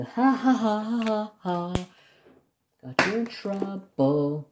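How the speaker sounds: high mocking ha-ha, then drawn-out tease; gloating taunt. Patient teasing doctor caught out by wife, gloating singsong. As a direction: crow it like a playground taunt.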